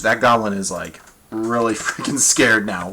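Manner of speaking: normal talking voice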